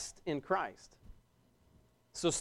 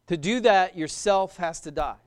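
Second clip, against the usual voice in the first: ordinary talk, nothing marked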